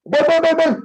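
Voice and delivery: high, loud repeated call. Retold or imitated. Wounded man in the bush calling out loudly.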